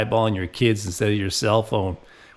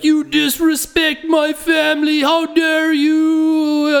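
screamed high and loud, hammed-up foreign accent; furious foreign dad shout; 6 dB louder, slower than usual. Offended father yelling at the flight attendant, mock furious shout. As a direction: bellow it like an outraged father defending his family. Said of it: "'cause he's like".

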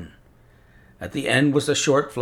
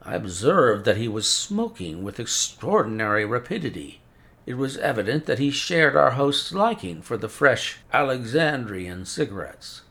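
usual speaking voice

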